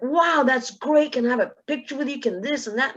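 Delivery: mock gushing fans. Fans gushing after semifinal.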